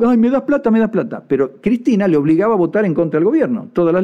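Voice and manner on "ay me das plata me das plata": mock whiny begging. Mocking governor begging for money.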